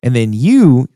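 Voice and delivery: ordinary talk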